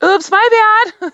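high, sheepish mock apology; mock oops. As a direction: toss off a playful little sorry. Jokes about apologizing for damaged truck.

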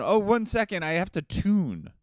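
loud, high, hammed-up stalling; stalling excuse. Faking piano skill over the phone, loudly stalling. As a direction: call out a frantic bluff. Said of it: "I'm YouTubing and I'm going".

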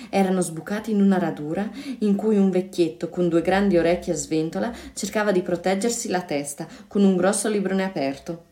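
plain reading voice

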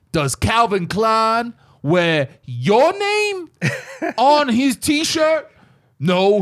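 drawn-out tough-guy sermon with laughs; Mister T impression. Mister T preaching to kids about designer t-shirts, in a hammed-up slow voice. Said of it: "yo, he's like".

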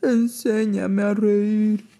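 slow begging bear voice; pleading bear. Acted. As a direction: plead slowly. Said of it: "le rogó".

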